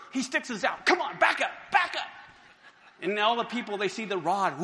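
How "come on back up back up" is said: higher, louder shooing command. Acted. Moses waving the rod at the crowd, higher shooing command. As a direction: drive the crowd back.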